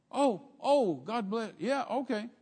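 put-on flustered old lady. The blue-haired lady embarrassed by Jackie's reply, flustered. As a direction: back off, embarrassed. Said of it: "She said".

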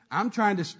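ordinary delivery

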